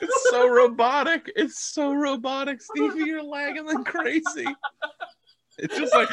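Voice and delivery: cracking up mid-sentence; laughing hard. Real, not acted. Laughing at lagging video.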